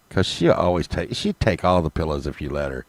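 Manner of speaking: usual speaking voice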